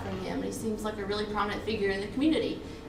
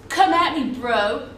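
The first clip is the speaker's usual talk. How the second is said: loud; 3 semitones higher, 9 dB louder, slower than usual. Acted. Roll call joke line.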